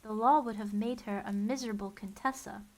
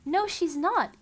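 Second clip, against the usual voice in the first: higher, louder eager defense; excited cry; 8 semitones higher, 7 dB louder, slower than usual. A narrator's reading. Klaus defending his sister, excited and higher. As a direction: jump in eagerly. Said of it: "cried excitedly".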